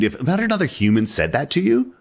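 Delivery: normal talking voice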